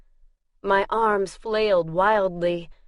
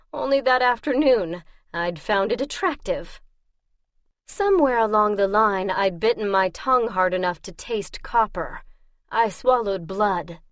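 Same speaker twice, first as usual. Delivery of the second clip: ordinary delivery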